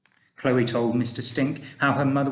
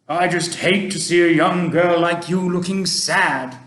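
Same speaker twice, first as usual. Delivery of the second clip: put-on a kindly old gentleman, louder and gently advising; Mister Stink; 5 semitones higher, 7 dB louder than usual. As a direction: speak like an old tramp giving fatherly advice. Mister Stink consoling Chloe.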